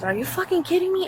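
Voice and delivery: indignant retelling; retold indignation. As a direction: quote an angry challenge, pitch jumping up. Retold quarrel at car door.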